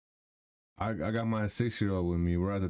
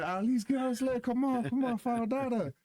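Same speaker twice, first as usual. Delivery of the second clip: put-on high, coaxing voice to a small kid; coaxing child; 13 semitones higher than usual. Father coaxing youngest to follow him, high coaxing. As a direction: sweet-talk a little girl onto her sled.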